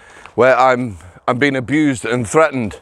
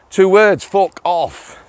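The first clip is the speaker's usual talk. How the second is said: put-on Yorkshire accent; 6 semitones higher, much slower than usual. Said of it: "in my finest Yorkshire accent".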